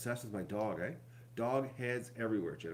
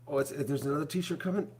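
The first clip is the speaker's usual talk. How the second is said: normal talking voice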